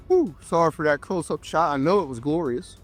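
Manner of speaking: ordinary delivery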